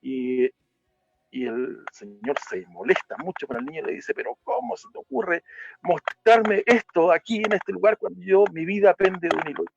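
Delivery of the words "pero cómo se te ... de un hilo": higher, louder indignant rebuke; man annoyed at the child, indignant and higher; angry scolding. Acted. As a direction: snap at the boy in disbelief.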